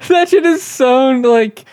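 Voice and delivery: high, loud burst of real disbelief; incredulous outburst. Real, not acted. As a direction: blurt it out way up high, then stretch it. Stunned by the claimed cost.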